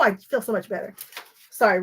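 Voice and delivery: ordinary delivery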